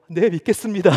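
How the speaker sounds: high, loud mock surrender through laughter; laughing imitation. Taxi driver gives in to pushy evangelist, laughing imitation. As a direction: crack up while giving the cowed reply.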